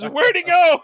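high loud puzzled cry; baffled shout. Retold or imitated. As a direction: shout it in disbelief. Mimicking baffled crowd at courthouse.

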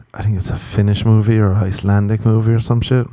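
ordinary talk

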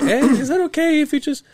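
squeaky imitation of a friendly caller; lightly mocking high polite ask. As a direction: pitch way up, mimicking the neighbor. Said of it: "they're already like".

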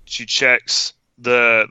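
ordinary delivery

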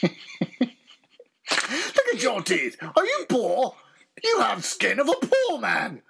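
high, snooty posh voice; exaggerated posh disgust; posh accent. Shown as a demonstration. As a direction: sneer like a posh snob. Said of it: "It's a posh accent".

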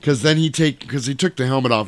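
ordinary delivery

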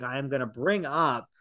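ordinary talk

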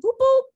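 high, loud little hoots; playful boop noise. Pretend. Gushing to listeners about a crush's accent, playful high boops.

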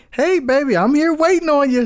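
high, loud sweet Southern lady voice; imitating a sweet older Southern lady calling; good Southern lady. Teller voicing Miss Blanche inviting him over. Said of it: "She's like".

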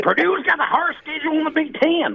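very high-pitched mock Indiana accent. Imitating the Indiana friend on Purdue, exaggerated Indiana accent. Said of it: "I never knew Indiana had an accent".

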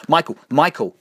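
loud, high-pitched cry of his name; urgent call. Acted. Belinda calls to Michael as killers close in, urgent shout. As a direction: shout the name to get attention.

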